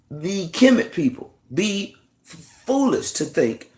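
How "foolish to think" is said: ordinary talk, nothing marked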